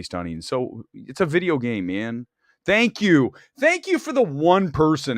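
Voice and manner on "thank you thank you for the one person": excited thanks. Real, not acted. Grateful someone agrees.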